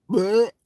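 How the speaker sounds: retold gag, half-played for laughs; mock gag. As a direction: imitate someone gagging on food. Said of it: "Bill Cook just gagged".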